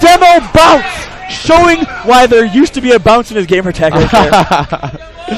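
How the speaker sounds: excited shout. Real, not acted. Caster erupts at clutch play.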